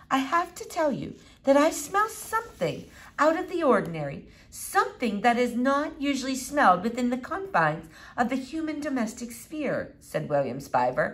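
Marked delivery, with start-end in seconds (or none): 0.1-9.9 a hint of a pompous boy, higher pitched; precocious boy making a wordy announcement; lightly self-important, higher voice.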